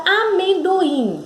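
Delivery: "amendoim" carefully sounded out by syllables; "amendoim" in measured beats, a-men-do-im; syllable demo. Shown as a demonstration. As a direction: pronounce "amendoim" distinctly for a class. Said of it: "quem é a sílaba tônica?".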